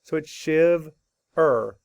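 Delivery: shiv-er split into two slow syllables, short i; syllables split slowly. Shown as a demonstration. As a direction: break "shiv-er" apart slowly. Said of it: "which means it's closed".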